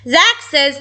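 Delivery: plain reading voice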